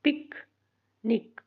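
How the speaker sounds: Picnic as short पिक, long pause, short निक; two short beats in picnic, far apart; split into syllables. Shown as a demonstration. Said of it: "इसको divide करने का".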